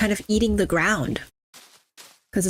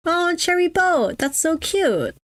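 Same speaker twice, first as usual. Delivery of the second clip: delighted cooing; 10 semitones higher, slower than usual. Real, not acted. Delighted by a cute boat.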